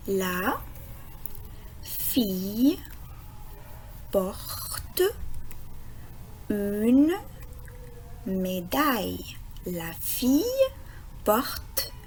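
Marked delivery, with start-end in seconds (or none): 0.1-9.4 word by word, long pause after each word; lecture mot à mot. Shown as a demonstration. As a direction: sound out LA... FILLE... PORTE... UNE... MÉDAILLE slowly. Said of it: "on va essayer de lire la phrase". (lecture lente, un mot à la fois)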